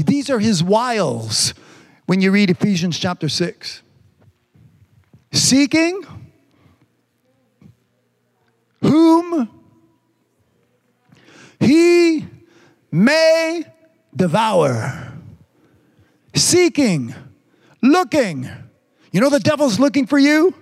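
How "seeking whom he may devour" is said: preacher spacing out the verse word by word; dramatic scripture reading. Real, not acted. Preacher slowly quotes scripture word by word.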